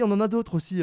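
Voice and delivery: ordinary talk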